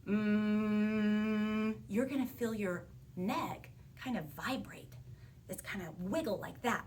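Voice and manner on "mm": long, level humming "Mm"; sustained voiced sound. Shown as a demonstration. Teacher showing a vibrating neck, sustained "Mm" hum. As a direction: hold "Mm" as a steady buzzing hum. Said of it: "say the sound mm".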